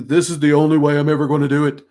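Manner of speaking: loud, rapid, mock-stubborn declaration; rigid insistence. Teacher mimicking a hard-locked mindset, rigid and insistent.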